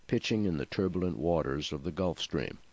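ordinary delivery, nothing marked

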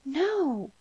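high, loud cry of refusal; gasp, then cry out high; girl crying out in shock; horrified cry. A narrator's reading. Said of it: "cried the girl".

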